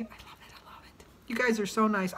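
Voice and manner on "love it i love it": soft grateful whisper. Real, not acted. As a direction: whisper it tenderly. Whispered gratitude.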